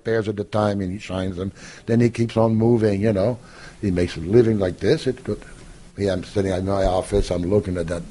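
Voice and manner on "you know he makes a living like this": normal talking voice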